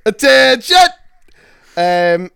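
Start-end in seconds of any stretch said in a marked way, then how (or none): 0.1-0.8 drill sergeant shout. Pretend. Military command joke.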